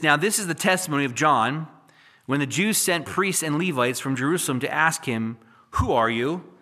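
loud, measured reading of scripture. Acted. Preacher reads Isaiah to his congregation, loud and solemn. Reads John chapter one aloud.

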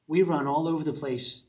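ordinary talk, nothing marked

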